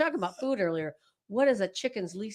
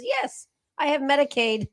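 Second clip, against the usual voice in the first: proud driver's answer; 6 semitones higher, 7 dB louder than usual. Acted. Driver's punchline answer in joke.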